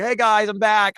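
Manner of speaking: high, loud mock announcement; mock cheerful greeting. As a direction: call it out like a breezy comeback. Mimics character's cheerful return.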